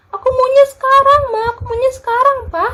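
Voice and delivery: put-on high, fast whiny kid begging; whining child. Child begging mama and papa for a toy, high whiny whine. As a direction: beg like a kid throwing a tantrum in a toy store.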